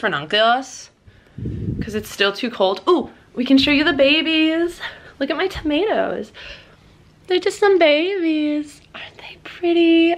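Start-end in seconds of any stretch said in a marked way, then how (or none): 2.9-6.3 high, delighted show-and-tell; bubbling over about the seedlings; excited. Real, not acted. Showing off baby plants. 7.3-10.2 cooing whisper. Real, not acted. Cooing over seedlings.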